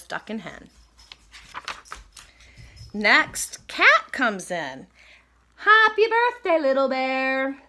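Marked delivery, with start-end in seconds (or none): 5.5-7.6 high, loud call that slows on the name; cheery birthday greeting. Acted. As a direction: sing out a greeting like a storybook cat. Cat greets Little Bear on birthday.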